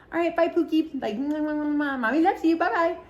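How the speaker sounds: high, sing-song cutesy goodbye; smoochy baby-talk farewell to a friend; cooing baby talk. Retold or imitated. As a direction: coo like a mom to a baby.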